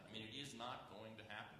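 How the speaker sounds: normal talking voice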